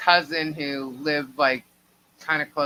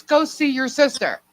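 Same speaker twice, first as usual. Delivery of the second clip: mother's ominous hint; 9 semitones higher, 5 dB louder than usual. Retold or imitated. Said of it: "She goes".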